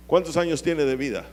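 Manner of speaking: usual speaking voice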